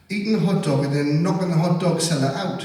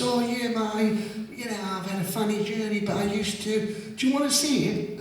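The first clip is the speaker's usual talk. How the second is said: night watchman; 8 semitones higher, 3 dB quieter than usual. Retold or imitated.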